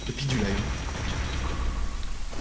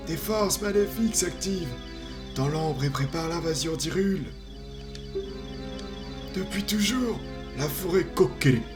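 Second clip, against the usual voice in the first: higher, solemn ancient tree spirit; wise old tree; 4 semitones higher than usual. Acted. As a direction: speak as a grave forest guardian. Voices the Deku Tree.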